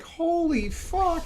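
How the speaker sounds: very high, drawn-out astonishment; slow, high-pitched stretched-out exclamation; mock amazement. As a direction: imitate someone totally floored. Acts out physio's amazed reaction.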